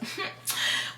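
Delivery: breathy little laugh; amused laugh mid-story; short laugh. Real, not acted. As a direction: laugh it off quickly. Laugh while retelling.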